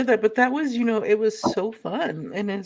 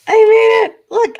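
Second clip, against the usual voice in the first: excited; 13 semitones higher, 11 dB louder than usual. Real, not acted. Triumphant after making the s'more.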